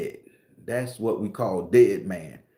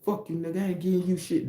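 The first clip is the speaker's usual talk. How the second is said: higher, defiant street comeback; street gangster talk; 6 semitones higher, 4 dB quieter, faster than usual. Shown as a demonstration. The other side of the mock robbery, in defiant street talk. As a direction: snap back at the robber. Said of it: "This is how we talk".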